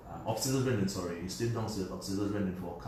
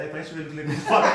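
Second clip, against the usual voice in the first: ordinary talk, nothing marked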